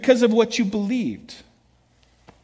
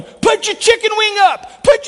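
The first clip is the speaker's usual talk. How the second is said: high, loud shouts from behind the plate; shouted coaching cries; coach yelling; 14 semitones higher, 7 dB louder than usual. Retold or imitated. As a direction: bellow it like a Little League coach. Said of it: "I'm yelling all this stuff".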